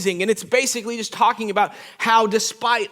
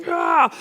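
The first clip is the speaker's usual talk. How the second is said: high, exultant "ah" yell; triumphant scream; 8 semitones higher than usual. Retold or imitated. Man standing atop the hill, screaming in triumph. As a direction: scream it from the hilltop. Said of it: "I'm standing up there screaming".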